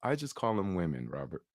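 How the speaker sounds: a gentle but firm rebuke; calm quiet correction. Retold or imitated. Friend quietly corrects his word choice, soft and stern. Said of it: "And you were like".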